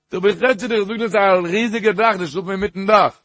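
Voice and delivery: high, loud, rushed retort; retold incredulous retort. Retelling a dialogue about sleeping on a huge roof, incredulous. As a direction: raise pitch and volume in mock disbelief.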